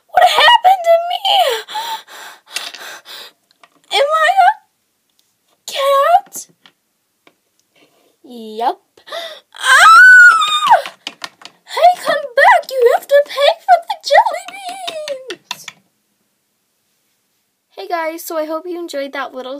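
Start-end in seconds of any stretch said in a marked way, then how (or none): 0.1-6.5 high loud panicked cry; panicked shout. Acted. Customer finds she's a cat. 9.5-10.9 very high loud shriek; scream. Acted. Screams at transformation. 11.2-15.7 high loud yell after her; shouting. Acted. Witch yelling after fleeing cat.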